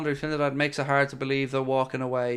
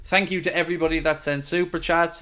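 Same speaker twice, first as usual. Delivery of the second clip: usual speaking voice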